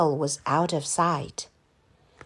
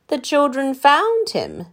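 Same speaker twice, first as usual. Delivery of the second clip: ordinary delivery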